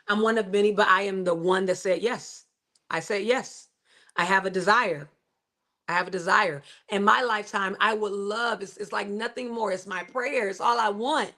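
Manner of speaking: ordinary talk, nothing marked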